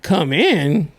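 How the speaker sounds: high, rising mock incredulity; exaggerated questioning squeal at the end; retold disbelief. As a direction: replay your baffled reaction, pitch shooting up. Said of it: "I was like".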